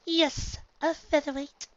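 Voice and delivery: high, posh mare voice; a refined lady pony; Rarity voice. A narrator's reading. Rarity answers the colt.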